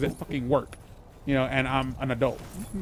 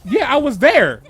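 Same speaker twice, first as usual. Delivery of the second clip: loud, higher, real insistence; cutting in, pitched up and loud; emphatic interjection; 7 semitones higher, 8 dB louder, slower than usual. Real, not acted. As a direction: jump in loudly to insist. Cuts in to insist he witnessed it.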